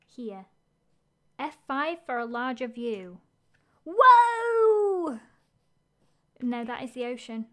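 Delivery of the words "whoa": loud, high amazed whoa; surprised whoa. Real, not acted. Seeing the larger map view, loud surprised whoa. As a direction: let the surprise burst out.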